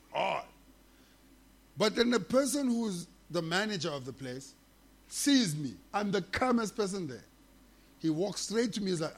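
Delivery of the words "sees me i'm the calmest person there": usual speaking voice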